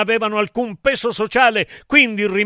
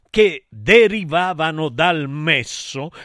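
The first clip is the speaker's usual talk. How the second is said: usual speaking voice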